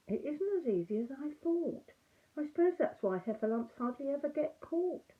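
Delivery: a puzzled little bear thinking aloud; Pooh bear. Acted. Pooh voiced in story.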